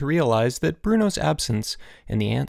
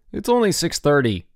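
raised, grumpy complaint; irritated yell; 6 semitones higher, 4 dB louder than usual. A narrator's reading. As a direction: complain loudly from bed. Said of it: "yelled a voice".

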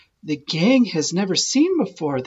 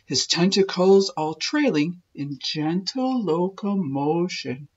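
plain reading voice

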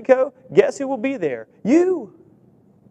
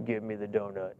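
very low, much softer growling craving voice; greedy flesh voice; 9 semitones lower, 14 dB quieter than usual. Pretend. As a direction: voice your flesh begging for the donut, deep and low. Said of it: "it'll sound just like that".

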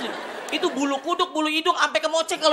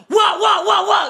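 higher, louder rhythmic shouts of wah; a paid audience member whooping on cue; 6 semitones higher, 4 dB louder, slower than usual. Shown as a demonstration. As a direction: cheer like a hired studio crowd. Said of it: "lu teriak-teriak aja".

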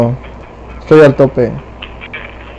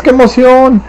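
high, thrilled squeal; squealing excitement; 9 semitones higher than usual. Pretend. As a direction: gush it like a giddy fan. Mock joy at reaching level sixteen.

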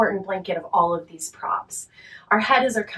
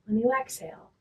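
guided relaxation; 5 dB quieter than usual. Real, not acted. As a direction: talk them gently down into rest, unhurried and quiet.

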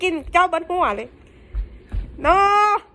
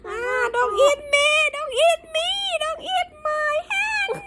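high, whiny mock terror; mock fear; 12 semitones higher, much slower than usual. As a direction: pretend to be scared of being eaten. Mock fear of being eaten.

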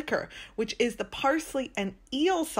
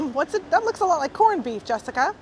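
lightly voiced questioner, pitched higher; imagined doubting viewer; 6 semitones higher, 3 dB louder than usual. Guide voices what a viewer might be thinking, lightly mimicked questioner. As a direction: put on a hint of a puzzled customer. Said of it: "Now you might be thinking".

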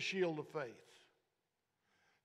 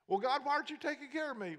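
high, hurried mock complaint to God; 7 semitones higher, 3 dB louder, faster than usual. Believer without faith asking God for care, mock complaint. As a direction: imitate a believer pleading, fast and raised in pitch.

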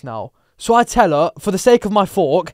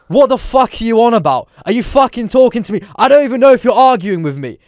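raised, incredulous challenge; loud, higher-pitched indignant questioning; confrontational tone; 5 semitones higher, 5 dB louder than usual. Retold or imitated. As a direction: snap back, baffled and on edge. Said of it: "in that exact tone".

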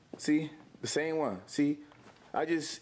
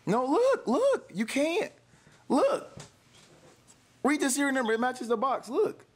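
high-pitched, urgent pleading; urgent insisting; 8 semitones higher, 3 dB louder than usual. Real, not acted. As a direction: insist in a much higher voice. Pleading that the phone is real.

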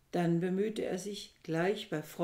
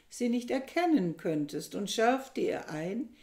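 plain reading voice

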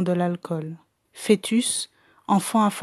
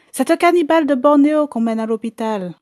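aigu et fort, crié; cris de la foule; 6 semitones higher, 6 dB louder than usual. Acted. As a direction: crier la nouvelle à la cantonade. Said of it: "des gens criaient". (des gens crient dans la rue, excités)

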